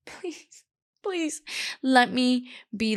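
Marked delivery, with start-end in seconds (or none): 0.1-1.4 high, tiny begging voice; desperate pleading. Pretend. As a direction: plead helplessly, much higher and softer. Begging noisy snack eater to stop.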